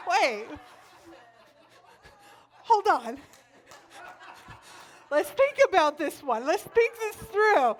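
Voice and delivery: high, loud halting second thoughts; comic reconsideration. Acted. Single babysitter reconsidering the fireman, comic second thoughts. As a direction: stop short, then push it up loud.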